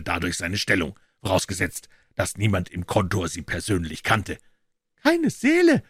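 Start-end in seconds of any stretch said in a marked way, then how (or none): 5.0-5.8 groaning Pycroft. A narrator's reading. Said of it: "stöhnte Pycroft".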